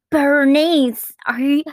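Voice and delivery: put-on high, loud squeal of a manic girl; half-hearted Japanese-accented cartoon girl; imitating Susie. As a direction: pitch it way up and call out her name. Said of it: "I can't do the Japanese accent".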